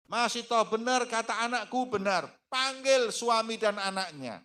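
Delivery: high, loud commanding shout; angry tyrant. Acted. Pharaoh shouting at Masyitoh to call her family, furious. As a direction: bellow the order like a furious king.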